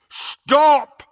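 loud, powerful, high-pitched command; thundering. A narrator's reading. Harry as Runcorn halts the wizards, thundering. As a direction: thunder it across the hall. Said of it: "Harry thundered".

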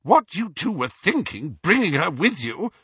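high, loud scolding; angry motherly telling-off; mother's shout. A narrator's reading. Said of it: "shouted Missus Weasley".

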